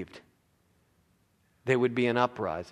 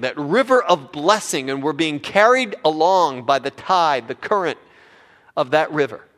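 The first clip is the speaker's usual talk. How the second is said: loud, raised sermon delivery; impassioned preaching; 4 semitones higher, 10 dB louder than usual. Real, not acted. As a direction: drive each phrase home from the pulpit. Preaches at full force.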